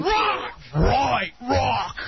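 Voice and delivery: high mock-rock cry; mock rocker yell. Sidekick heckling a Korn fan caller, high mock rock cries. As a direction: yelp it high like a hammy rocker.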